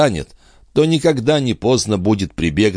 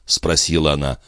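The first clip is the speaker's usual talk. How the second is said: usual speaking voice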